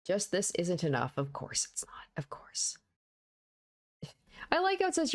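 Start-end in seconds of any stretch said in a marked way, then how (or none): 1.2-2.8 breathy whisper under breath. Acted. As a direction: whisper it to yourself. Inner voice muttered aside.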